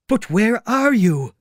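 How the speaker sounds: high, loud, drawn-out cry; desperate demand. Acted. Tramp threatened with stones by the unseen voice, desperate. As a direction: call out slowly, high and baffled.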